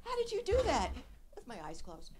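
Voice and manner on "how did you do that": high, breathless gushing praise; imitating thrilled crewmates; gushing fan. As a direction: squeal it like an amazed admirer. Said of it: "You know".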